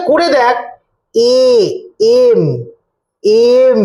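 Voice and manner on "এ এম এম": "এম" opening on a long pulled E; drawn-out E vowel. Shown as a demonstration. Teacher showing the vowel sound in M, "এম" drawn out. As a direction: stretch the vowel of "এ" and "এম", pausing between. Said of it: "এটা এ টান আসছে".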